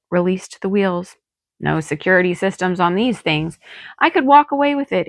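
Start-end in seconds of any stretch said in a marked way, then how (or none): none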